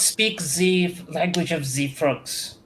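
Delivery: put-on German officer with a French-ish accent; haughty fake French accent. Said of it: "that was my fake French accent".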